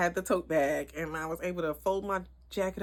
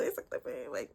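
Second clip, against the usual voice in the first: whispered; 9 semitones lower, 12 dB quieter than usual. Retold or imitated. Confiding about fake nose ring.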